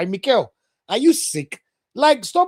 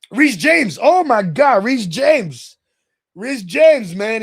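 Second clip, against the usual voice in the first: raised, loud fan exclamation; excited admiration; 6 semitones higher, 8 dB louder, much slower than usual. Real, not acted. Fan raving about a Chelsea player he respects, excited admiration. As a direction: rave about a favourite player, high and loud.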